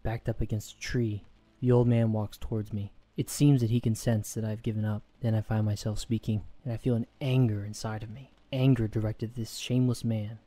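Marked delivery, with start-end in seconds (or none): none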